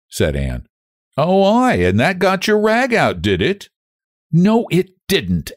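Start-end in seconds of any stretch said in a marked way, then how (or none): 1.2-3.7 high-pitched broad country dialect. A narrator's reading. Young man needling Anne in broad dialect. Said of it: "I can't bear you to talk broad". 4.3-5.5 slow, high-pitched mild scolding; sharp scolding. A narrator's reading. Anne scolding the young man sharply, understated. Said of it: "Anne scolded sharply".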